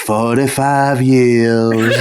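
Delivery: very slow, weary Southern drawl; foppish Southern drawl. Retold or imitated. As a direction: drag it out like a worn-out dandy. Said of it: "And he's like".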